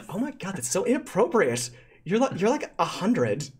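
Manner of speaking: high, swooping schoolgirl voice; put-on high voice of a disgusted teen; appalled teen girl. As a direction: recoil like a scandalised teenage queen bee. Prom-queen character disgusted by older man.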